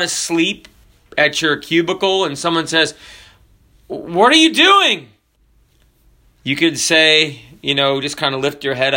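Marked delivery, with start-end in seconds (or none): 3.9-5.1 high-pitched startled-boss question; acted challenge. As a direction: demand it as if catching someone asleep. Someone catches a sleeper at work.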